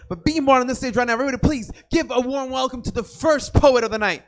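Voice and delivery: loud, high, fast sing-song intro; hype announcer. Real, not acted. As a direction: build to the name like an MC. Introducing first poet.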